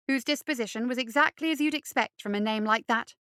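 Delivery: ordinary delivery, nothing marked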